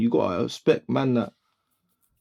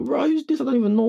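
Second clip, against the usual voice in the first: pitched up high in mock disbelief; baffled surprise; 13 semitones higher than usual. Spotting an unknown Birmingham artist with big views, high and baffled. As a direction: sound genuinely thrown by a stranger.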